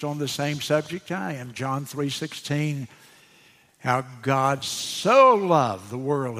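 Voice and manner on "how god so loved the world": slow "how God so loved the world", the word "so" leaned on; "so" stretched, the rest of the phrase falling; stress on so. Retold or imitated. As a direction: retell the pastor's emphasis on "so". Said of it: "he emphasized that word so".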